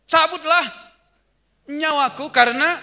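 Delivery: loud, high, threatening plea; threatening prayer. Acted. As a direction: threaten God with your own life. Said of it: "Tuhan diancam loh".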